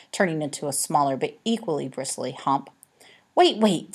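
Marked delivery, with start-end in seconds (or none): 3.4-3.9 louder, higher urgent call. A narrator's reading. Olive breaking up the cats, urgent louder call. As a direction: stop the fight at once. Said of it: "said Olive".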